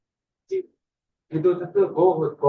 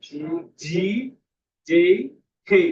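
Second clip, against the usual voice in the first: letters called out louder, one at a time; raised voice, spaced-out letter names; louder for the listener; 4 dB louder, slower than usual. Shown as a demonstration. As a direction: speak up and announce each letter separately. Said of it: "Please loud".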